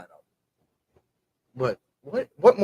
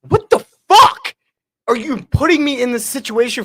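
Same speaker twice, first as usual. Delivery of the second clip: stunned; 11 semitones higher, 5 dB louder than usual. Retold or imitated. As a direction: squeal it in shocked disbelief. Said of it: "And I'm like".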